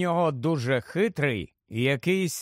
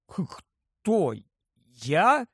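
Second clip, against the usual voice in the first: stammering surprise; much slower than usual. A narrator's reading. Harry is asked to be godfather, stammering in surprise. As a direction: stumble over it, taken aback. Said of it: "затинаючись, перепитав Гаррі".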